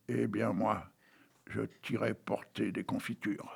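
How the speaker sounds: quietly promising jam; said low. A narrator's reading. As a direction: drop it down to a secret. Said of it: "m'a dit tout bas".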